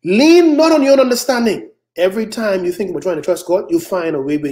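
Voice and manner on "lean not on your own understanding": loud, high scripture cry; forceful exhortation. Real, not acted. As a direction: call the verse out to the congregation. Exhorts congregation with shouted scripture.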